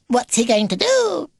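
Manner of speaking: squeaky, high little question; baby beaver, curious. Acted. Baby beaver asking about the mission, squeaky and curious. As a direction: pipe it up eagerly, very high.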